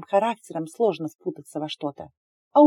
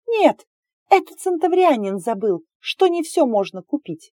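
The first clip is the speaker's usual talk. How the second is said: much higher, louder scornful retort; indignant mockery; 7 semitones higher, 3 dB louder than usual. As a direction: throw his words back, outraged. Heroine fires back at him.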